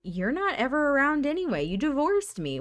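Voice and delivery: high, indignant acted retort; defensive anger. Ex-husband arguing back at Nancy, defensive.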